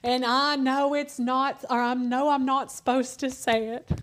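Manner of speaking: put-on high, slow, sheepish kid voice; son confessing. Mother imitating her son telling the teacher, slow and high. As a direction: drag it out like a guilty little boy.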